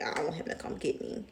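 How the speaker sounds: unvoiced whisper, sulky; petty whisper. Petty refusal told to viewers.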